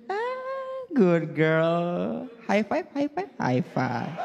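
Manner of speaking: high, gentle English-speaking rich dad; posh mall dad. Retold or imitated. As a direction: coo at the child like a rich Jakarta father. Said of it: "bahasa Inggris".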